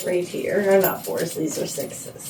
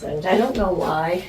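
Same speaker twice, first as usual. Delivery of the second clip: ordinary delivery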